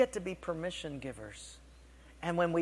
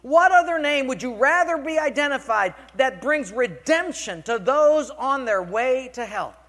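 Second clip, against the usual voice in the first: high, loud thundering questions, building; God's impassioned rebuke; 8 semitones higher, 10 dB louder than usual. Retold or imitated. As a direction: hammer each question home at full preaching force.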